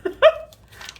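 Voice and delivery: high, loud sheepish laugh burst. Real, not acted. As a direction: laugh it off before recounting. Laughing at having to recount.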